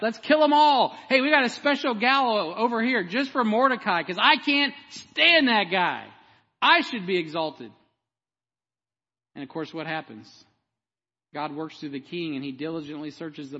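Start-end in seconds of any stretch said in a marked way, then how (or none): 0.0-7.7 loud, high mock rant as a plotting villain. Preacher acts out wicked Haman plotting against Mordecai, ranting. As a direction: shout it as a self-important schemer.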